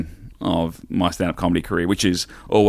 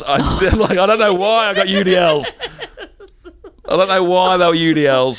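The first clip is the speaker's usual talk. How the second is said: amused disbelief; 10 semitones higher than usual. Real, not acted. Laughing at own choice.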